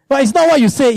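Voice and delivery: ordinary talk, nothing marked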